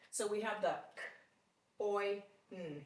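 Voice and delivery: k, oi, n said apart as separate sounds; sounding out. Shown as a demonstration. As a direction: segment coin into k, oi, n. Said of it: "pronounce each part of the word".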